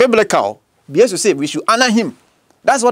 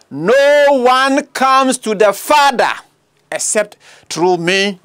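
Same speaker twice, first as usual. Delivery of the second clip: very slow, higher, then falling; slow climax reading; 4 semitones higher, slower than usual. Acted. Preacher slowly reading no one comes to the Father. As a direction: stretch it out with weight.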